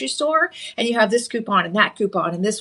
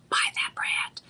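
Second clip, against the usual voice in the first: stage whisper; 9 dB quieter than usual. Acted. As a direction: whisper "buy that brand" like a secret tip. Confiding aside to viewers.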